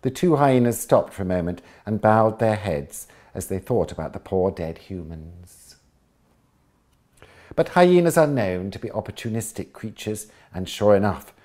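bright, lively storybook read; lively read-aloud of a children's book; story narration. A narrator's reading. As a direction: tell it like a bedtime story for kids.

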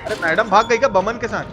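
ordinary delivery

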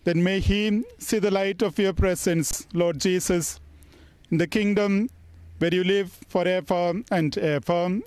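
ordinary talk

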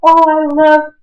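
high, loud burst of real delight; excited squeal. Real, not acted. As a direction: blurt it out thrilled at the sight. Fan sees favourite character appear.

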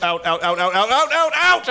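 rising panicked chant, climbing to a yelp. Real, not acted. Trapped gamer begging his character out, building panic.

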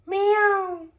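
high tiny kitten meow. Pretend. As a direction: mew like a little kitten. Parent voices kitten.

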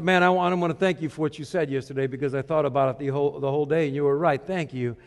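lightly voiced man, grateful and hushed; a hint of a humbled driver; quiet thanks. The driver thanks him next day.

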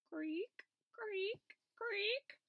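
high drawn-out creak; rising squeaky creak; creaking stairs. A narrator's reading. Narrator voicing stair creaks.

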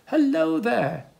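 high, loud call across the water; friendly dodo calling hello. Acted. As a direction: call out to the boat. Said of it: "he called to them".

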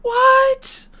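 high squeaky disbelief; high-pitched horrified reaction to the creepy book; shocked falsetto. Real, not acted. Reacts to book about breaking legs.

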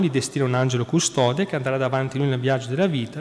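ordinary delivery

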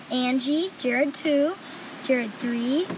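ordinary delivery

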